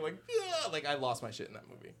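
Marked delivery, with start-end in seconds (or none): 0.3-0.7 high, mock sobbing wail; imitated church sob. Friend retelling how they cried at the movie, imitated sob. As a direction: imitate a shoulder-shaking sob. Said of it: "church sob, like, shoulder shaking".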